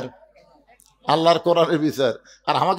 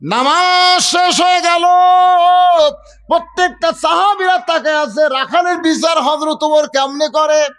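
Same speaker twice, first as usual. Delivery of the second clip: high, ringing sermon delivery; full-force preaching; 10 semitones higher, 5 dB louder than usual. Real, not acted. Preacher tells the congregation of Omar judging the shepherd, high impassioned preaching. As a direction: preach it loud on a sustained high pitch.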